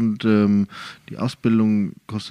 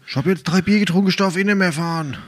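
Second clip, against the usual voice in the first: tipsy parent; 6 semitones higher, faster than usual. Retold or imitated. Voicing tipsy parents at fair.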